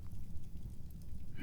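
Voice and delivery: a quiet, airy demon laugh; soft breathy chuckle. Acted. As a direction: chuckle under your breath, amused.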